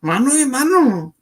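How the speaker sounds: high, loud mock-macho challenge; put-on challenge voice. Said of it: "Why did you have to use that voice".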